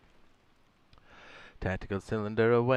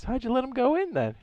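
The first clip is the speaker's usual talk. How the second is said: incredulous; 13 semitones higher than usual. Real, not acted. Incredulous reply to chat.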